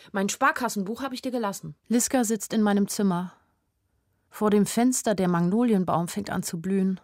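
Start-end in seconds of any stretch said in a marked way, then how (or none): none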